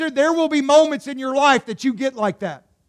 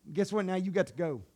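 usual speaking voice

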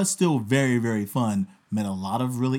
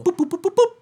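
beep noises; 4 dB louder, faster than usual. Retold or imitated. Imitating phone keypad beeps.

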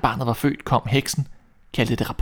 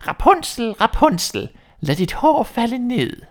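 much higher, slow witch call up the tower; 11 semitones higher, 4 dB louder, slower than usual. Acted. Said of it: "stillede hun sig neden for tårnet og råbte".

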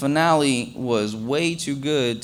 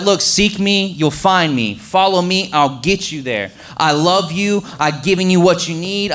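louder, stirring pulpit delivery with slow hammered lines; preaching; 2 semitones higher, 4 dB louder than usual. Acted. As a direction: preach it to the room. Preacher drives home the sermon.

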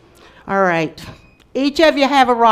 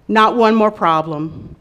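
ordinary talk

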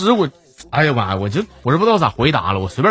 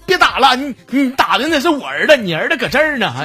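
raised higher voice, calling out to stop; neighbour calling out; 7 semitones higher than usual. Retold or imitated. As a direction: shout across to stop the beating. Neighbour calls out to stop beating.

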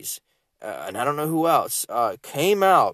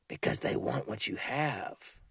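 much softer and slower, drawn out; soft, slow answer; 10 dB quieter than usual. Acted. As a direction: let the answer sink in quietly. Answers own question, then repeats line.

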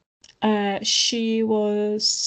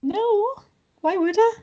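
high-pitched, defensive comeback; incredulous denial; 11 semitones higher, slower than usual. Real, not acted. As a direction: push back as if it's absurd. Indignant reply to shocked friend.

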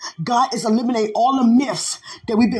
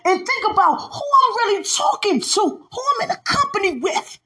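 preaching at full force; 10 semitones higher than usual. Acted. Preacher to the congregation, defying Satan, in full-force preaching. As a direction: shout it out over the music, taunting the devil.

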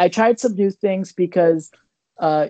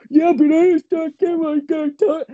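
mocking heckler; 9 semitones higher than usual. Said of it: "heckler's voice mocked".

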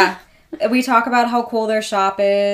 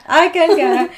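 higher, louder hammed-up British "I concur"; weird mock British accent; 8 semitones higher, 8 dB louder than usual. Hosts repeat their catchphrase in a mock British accent. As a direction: say it like a stuffy old English gent. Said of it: "With a weird accent".

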